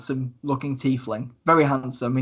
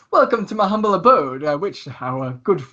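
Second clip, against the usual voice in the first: Lucius, grand host; 2 semitones higher than usual. Acted. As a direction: play the showy host, stretching the words. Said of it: "And he goes".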